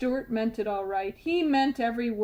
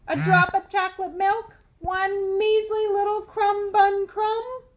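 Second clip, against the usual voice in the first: begging tooth; 8 semitones higher, 5 dB louder than usual. A narrator's reading. Tooth begs.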